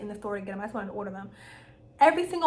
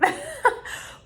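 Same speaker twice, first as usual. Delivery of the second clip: bright pitched-up burst of laughter; high laugh; 5 dB louder than usual. Real, not acted. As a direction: laugh at yourself. Laughs at herself.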